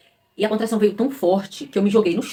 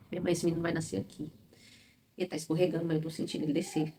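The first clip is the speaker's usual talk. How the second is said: retold crying; 9 dB quieter than usual. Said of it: "chorando de dor".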